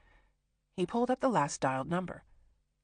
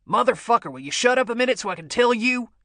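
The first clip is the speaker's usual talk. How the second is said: loud, higher yell into the phone; angry shout; 5 semitones higher, 8 dB louder than usual. Acted. Bo loses patience with Gerald on the phone, loud angry yell. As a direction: blow up at the caller.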